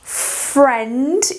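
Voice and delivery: "friend" with a short open E, rising; teacher modelling "friend" for students, short E. Shown as a demonstration. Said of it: "quando a gente acha um I e um E".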